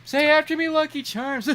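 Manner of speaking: high mock partygoer voice; drunk party guest. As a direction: coax the Irish guy, laughing. Party guest coaching Irish words.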